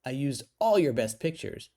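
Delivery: loud, high-pitched boasting as an excited daughter; acted kid voice bursting with pride; proud announcement. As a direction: keep the kid's thrilled reveal going, easing slightly. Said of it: "her face beaming with pride".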